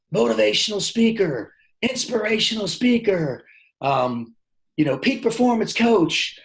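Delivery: high-pitched crowd shouting out guesses; eager audience calling out. Retold or imitated. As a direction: call out answers like a keen audience. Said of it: "And they're like".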